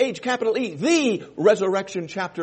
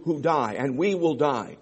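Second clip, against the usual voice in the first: normal talking voice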